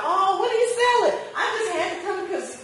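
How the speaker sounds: high, excited, drawn-in customer voice; eager customer. Retold or imitated. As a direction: gush like a customer pulled to the stall. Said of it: "And then they'll find out".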